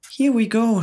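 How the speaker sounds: high, bright shout of glee; excited shout. A narrator's reading. Old green grasshopper shouting with excitement. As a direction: call out, hopping with excitement. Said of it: "shouted the old green grasshopper".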